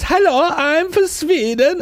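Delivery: high, slow put-on Swedish accent; lilting mock-Swedish greeting, drawn out. Jamie Lee Curtis introducing herself in disguise, mock Swedish accent. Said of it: "Jamie Lee Curtis comes up and says".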